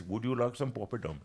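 lightly imitated Indian accent, softly spoken; put-on Indian accent. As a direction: offer it politely in a mock Indian accent. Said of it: "I have to put the accent on".

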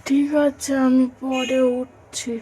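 slow, drowsy reply from bed; sleepy mumble. Acted. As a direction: drag it out half asleep. Husband half asleep in bed. (ঘুমজড়ানো গলায় ধীরে)

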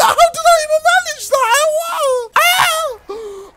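very high, fast screaming disbelief; euphoric shriek. Real, not acted. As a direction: shriek it, overwhelmed by the win. Celebrates an unexpected success.

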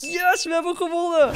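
high excited cheer. Real, not acted. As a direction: celebrate the win. Victory cheer.

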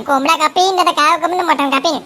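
ordinary talk, nothing marked

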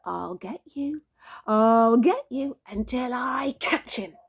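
slow, drawn-out teasing threat; playful menace. A narrator's reading. Dad chasing baby, mock-menacing and slow.

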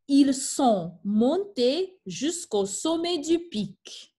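slow, careful model sentence; teacher modeling a French sentence, slow; modeled French reading. Shown as a demonstration. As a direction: read it clearly for the class to copy. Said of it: "teacher models French sentence".

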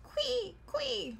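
very high imitated shoe squeak; short falling high squeaks; squeaky shoes. A narrator's reading. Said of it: "his shoes that were so new that they cried out".

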